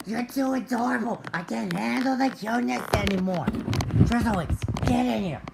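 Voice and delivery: overwhelmed by cuteness; excited gush. Pretend. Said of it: "Sugar's like".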